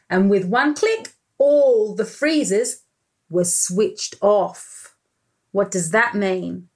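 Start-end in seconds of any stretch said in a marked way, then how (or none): none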